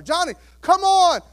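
put-on loud, high call to a scared child; father calling up from the pool, loud and high. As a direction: shout up encouragement from the pool below.

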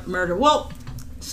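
usual speaking voice

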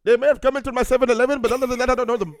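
loud hammed-up corner-store clerk voice; store clerk character. Answering the hosts as a store clerk, hammed up loud. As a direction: play the mixed-up shop owner, loud and proud.

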